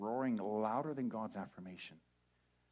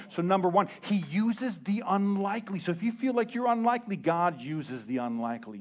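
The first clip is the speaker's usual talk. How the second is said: louder, rising preacher build; full-force preaching; 4 semitones higher, 8 dB louder than usual. Acted. Preacher firing up the church, loud. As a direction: drive it home to the congregation.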